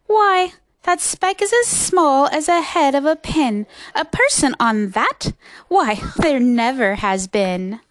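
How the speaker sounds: loud incredulous put-down; sour kangaroo. A narrator's reading. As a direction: scoff loudly as a sour kangaroo. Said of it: "'Twas a sour kangaroo".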